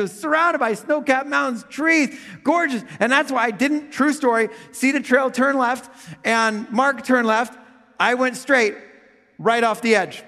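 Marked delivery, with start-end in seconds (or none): none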